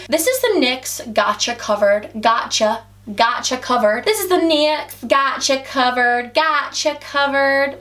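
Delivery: slow, drawn-out American "Gotcha Covered"; mock American accent. Shown as a demonstration. Vlogger retrying a product name, exaggerated American accent. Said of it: "I can't even say it in American".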